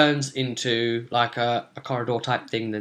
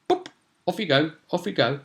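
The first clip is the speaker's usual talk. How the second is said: light, higher-pitched little send-off; coaxing a mouse; 4 semitones higher, 3 dB quieter, faster than usual. Acted. Player nudging his pet mouse round the corner, gently coaxing. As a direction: softly shoo a tiny pet along.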